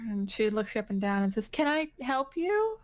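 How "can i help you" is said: higher-pitched nurse's query; questioning nurse. Acted. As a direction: ask it as a nurse on the door. Nurse questions Jane at door.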